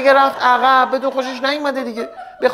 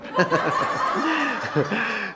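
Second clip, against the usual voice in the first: off-mic laughter; 8 dB quieter than usual. Real, not acted. Laughs at own joke.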